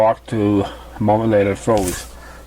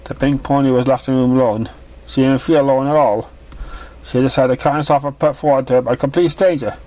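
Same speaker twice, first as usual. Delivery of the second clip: plain reading voice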